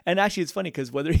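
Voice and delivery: ordinary talk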